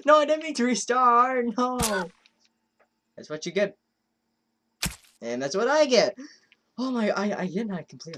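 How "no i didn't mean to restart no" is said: high, loud cry of protest; dismayed wail. Real, not acted. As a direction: cry out at your own mistake. Accidentally restarts the game.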